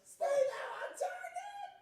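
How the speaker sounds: high, loud cry, breaking into laughter; laughing shout. Acted. Pilot yelling at his wingman, laughing. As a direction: scream the order while cracking up. Pilot orders during trench run.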